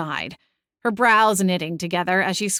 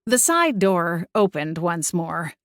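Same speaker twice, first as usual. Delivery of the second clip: ordinary delivery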